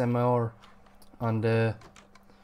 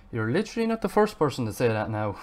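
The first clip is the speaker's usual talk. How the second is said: usual speaking voice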